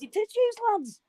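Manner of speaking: ordinary talk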